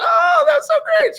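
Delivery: squeaky falsetto delight; mock gushing woman. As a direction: gush in an exaggerated high girl voice.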